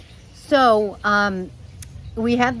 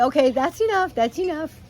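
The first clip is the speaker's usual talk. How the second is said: high, firm telling-off; scolding the parrot; 8 semitones higher than usual. Real, not acted. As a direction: stop the bird from drinking, raised and firm. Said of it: "scolding the parrot".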